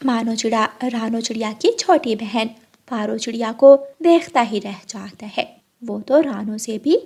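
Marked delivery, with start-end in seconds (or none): none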